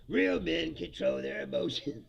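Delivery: a lightly voiced macho pundit; mock macho voice. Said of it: "You're like".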